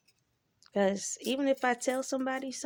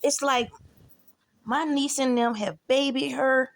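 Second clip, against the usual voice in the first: louder, slowing indignant complaint; exasperated rant; 4 semitones higher, 5 dB louder, slower than usual. Real, not acted. Complaining about her niece babying her, louder exasperation. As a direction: vent it, getting louder.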